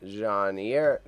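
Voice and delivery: put-on Jane Eyre in an overdone French accent, zhahn eer. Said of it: "I went too much with the French accent".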